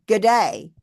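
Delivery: "Good day." said as a relaxed Australian greeting, falling at the end; Australian model greeting with a falling "day". Shown as a demonstration. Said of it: "So you say".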